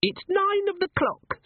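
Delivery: very high, slow squeaky announcement; little imp, pitched extremely high; imp announcing time. Acted. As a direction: pipe it out like a tiny town crier.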